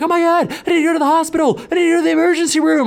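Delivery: high, fast panicky mimic of the friend; hammed-up freak-out; mock panic. Comic imitating his panicking friend begging for hospital. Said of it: "he's like".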